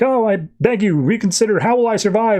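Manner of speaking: high-pitched theatrical lament; mock melodramatic begging. Exiled man begs as he's teleported out, mock melodrama.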